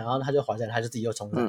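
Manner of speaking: ordinary talk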